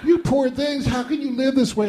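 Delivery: high, tearful voice of a Southern mother; mother arriving, crying at the mess, pitying voice. Retold or imitated. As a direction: wail it like a dismayed mother. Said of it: "She says".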